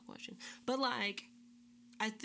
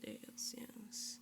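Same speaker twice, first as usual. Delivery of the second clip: whisper; 2 semitones higher, 6 dB quieter than usual. Real, not acted. Whispered agreement.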